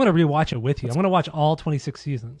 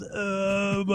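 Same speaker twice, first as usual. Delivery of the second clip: put-on higher, dazed drunken groan; drunk noise; 6 semitones higher than usual. Drunk [name] mumbling at his wife, a dazed groan. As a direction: grunt like a wasted rock star. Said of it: "just makes a fucking noise".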